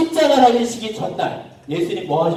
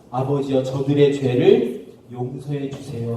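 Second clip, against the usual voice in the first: low, slow, earnest plea; solemn prayer; 6 semitones lower than usual. Acted. Jesus asking the Father to forgive his mockers, solemn and tender. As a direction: pray gently in a lowered voice.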